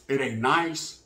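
low, soft, subdued "It ain't nice"; brother's quiet understatement. Retold or imitated. Brother on the phone, downplaying prison, low and soft. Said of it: "This is how he said it".

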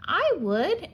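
high, small urchin voice; a spiky little creature agreeing; little sea urchin. A narrator's reading. Sea urchin answers crab.

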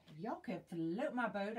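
ordinary delivery

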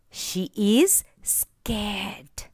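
slow frightened whisper; scared whisper. Acted. Teacher acting the scared girl for children, slow whisper. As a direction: whisper it very slowly, as if afraid to be heard.

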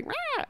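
a high, quick mock roar of rage; mock angry roar. Imitating women waking up to their anger, mock roar. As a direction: toss off a playful frustrated roar. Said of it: "I'm so angry".

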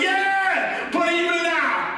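high, triumphant shout; soaring pulpit yell; faith's shout. Acted. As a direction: shout it out, stretching the words. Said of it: "our faith shouted".

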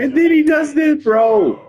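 high, loud burst of protest; exasperated shout. Real, not acted. Exasperated outburst at the game.

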